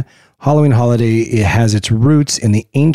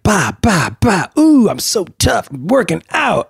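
playful, higher boxing bravado; mock tough boxer; 6 semitones higher, slower than usual. Host teasing co-host about her boxing video, mock tough. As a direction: voice punch noises, then a showy boast. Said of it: "she's like".

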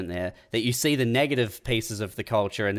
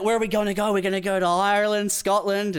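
much higher, excited producer voice; mock film execs; 9 semitones higher than usual. Studio execs planning locations, high hammed-up eagerness. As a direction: pitch it like eager studio people. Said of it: "they're like".

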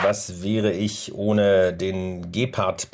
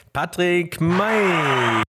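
very slow, high boxing ring call; ring announcer; 7 semitones higher, 3 dB louder, much slower than usual. Acted. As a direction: stretch the name out like a fight announcer. Introducing guest like ring announcer.

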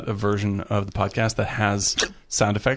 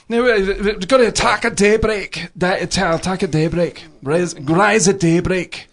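higher, lightly put-on Scottish voice; mock Scottish comedian; 6 semitones higher, slower than usual. Quoting Coogan doing a Scottish comedian in The Trip, lightly put-on Scottish voice. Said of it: "Steve Coogan does him".

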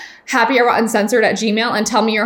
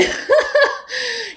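high burst of laughter; real laugh. Real, not acted. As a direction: laugh at your own fib. Laughing at her own story.

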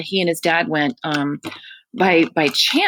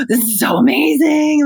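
put-on high, loud thrilled child; amazed kid; 10 semitones higher, 4 dB louder than usual. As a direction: gush like a wowed kid. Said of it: "he was like".

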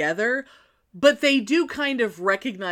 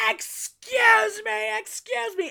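much higher, slow, faux-polite; drawn-out sarcastic courtesy; a hint of icy politeness, much higher; mock polite excuse me; 12 semitones higher, slower than usual. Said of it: "starts going".

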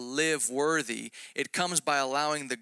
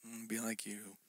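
much softer, grudging give-in; reluctant mumble; 3 semitones lower, 15 dB quieter than usual. Retold or imitated. Answering the Lord, a grudging, far softer give-in. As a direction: mumble it like you lost the fight. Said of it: "And I was like".

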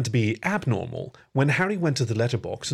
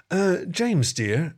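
ordinary delivery

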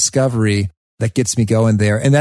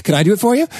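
eager; 9 semitones higher, much faster than usual. Retold or imitated. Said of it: "I was like".

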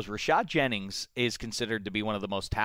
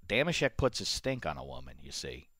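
low put-on voice; 9 semitones lower, 8 dB quieter than usual. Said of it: "Why you change your voice?".

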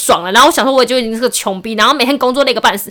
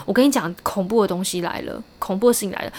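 dropped way down, softer, like telling a ghost story; hushed suspense; 4 semitones lower, 9 dB quieter than usual. Real, not acted. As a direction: lower the voice to build dread. Building suspense in scam story.

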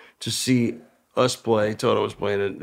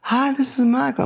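high breathy celebrity voice on the phone; imitating Michael; 13 semitones higher than usual. Michael introducing himself on the phone, high imitation.

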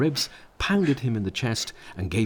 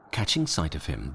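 usual speaking voice

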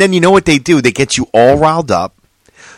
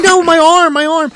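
high panicked cry; 4 dB louder than usual. Retold or imitated. [name] voiced being punched.

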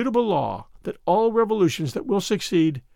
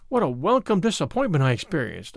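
ordinary delivery

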